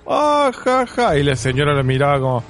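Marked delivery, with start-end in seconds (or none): 0.0-1.2 high, cackling put-on Tincho voice; mock shouting Tincho. Friend imitating Tincho yelling at the greengrocer, hammed-up cackle. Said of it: "Y Tincho empezó los gritos".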